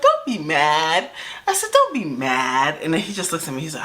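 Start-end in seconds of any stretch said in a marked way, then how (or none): 0.0-2.8 coaxing. Retold or imitated. Retells coaxing the jealous driver.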